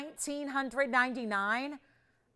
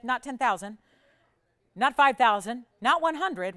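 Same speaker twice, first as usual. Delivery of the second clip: emphatic build; 8 dB louder than usual. Acted. Sales host building the price drop.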